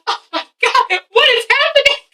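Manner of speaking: high-pitched, hammed-up disbelief; retold shock. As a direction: gasp it out high, replaying the shock. Her retold shock at the fight.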